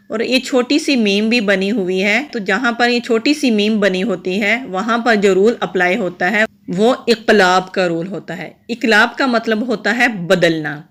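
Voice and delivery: ordinary talk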